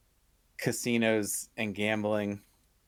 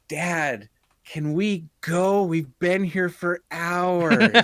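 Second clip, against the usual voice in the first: slow, drawn-out whine, higher and louder; whiny kid begging; 5 semitones higher, 6 dB louder, much slower than usual. Retold or imitated. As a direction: nag dad like a bored kid who wants to go home. Kid pestering dad to leave.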